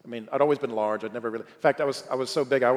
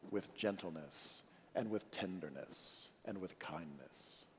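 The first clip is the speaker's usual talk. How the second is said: tender hush; 6 semitones lower, 18 dB quieter, slower than usual. Real, not acted. Preacher dwells on father's tenderness.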